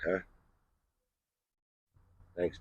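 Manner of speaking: ordinary talk, nothing marked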